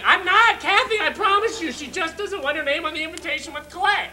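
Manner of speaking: high, loud protest of innocence; frantic denial. Acted. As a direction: insist loudly that it is true. Loud denial when accused of lying.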